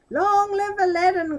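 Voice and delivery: high, loud cheering shout; a joyful street crowd hailing Aladdin. Acted. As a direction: cheer him like a jubilant crowd. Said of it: "cried the crowd".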